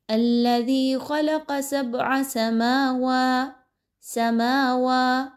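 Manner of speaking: سَمَاوَا with mim and waw stretched the same; equal long vowels. Shown as a demonstration. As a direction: stretch both vowels of سَمَاوَا two counts each. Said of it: "یہ correct انداز ہے". (میم اور واو برابر کھینچیں)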